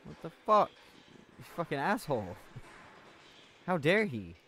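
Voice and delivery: loud indignant swearing; outraged. Real, not acted. Player fuming at an attacker, loud indignant swearing.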